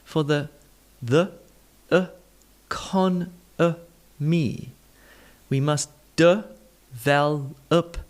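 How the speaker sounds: "the economy. We must develop" broken into slow separate syllables, uh in the weak ones; syllable-by-syllable schwa. Shown as a demonstration. Teacher dissecting the sentence for learners, slow separated syllables. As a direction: sound out "economy" as uh-con-uh-me, pausing between syllables. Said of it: "let's take a look at it".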